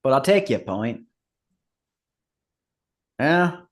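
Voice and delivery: ordinary delivery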